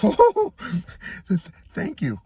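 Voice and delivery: sputtered thanks. Retold or imitated. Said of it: "I managed to sputter out".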